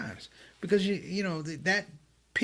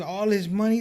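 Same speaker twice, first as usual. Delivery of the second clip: scolding elder; 6 semitones higher, 6 dB louder than usual. Retold or imitated. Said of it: "He said".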